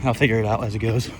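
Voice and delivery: while running. Real, not acted. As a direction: speak over footsteps while running. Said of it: "about halfway through the warm-up".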